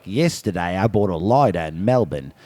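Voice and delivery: low, soft Aussie voice, lighter as loidah; fooling people while drunk in Australia; drunk Australian accent. Retold or imitated. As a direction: roll up on strangers with a low put-on Aussie line. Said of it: "I'd be like".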